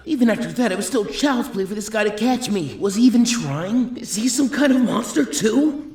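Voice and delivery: high, strained and slowing; rising panic, nearly laughing; panicked disbelief. Acted. Trapped fighter panics internally.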